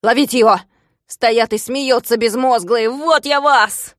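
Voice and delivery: loud, slow threatening yell; angry yelling. Acted. Klops threatening the laughing shorties, angry yelling. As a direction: threaten the gawking workers.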